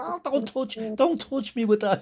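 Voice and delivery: high-pitched mock indignant outburst; imitating outraged father. Young lad's father to man with rival scarf, mock outrage. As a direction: act out a dad losing it, pitch shooting right up. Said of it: "the father nearly, oh, lost it".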